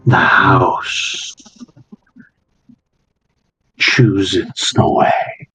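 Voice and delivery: slow, low and eerie; ominous servant voice. Acted.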